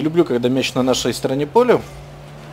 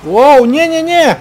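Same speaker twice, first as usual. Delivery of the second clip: high, slow alarmed "no no no"; alarmed shout; 10 semitones higher, 6 dB louder than usual. Real, not acted. Alarm as opponent attacks.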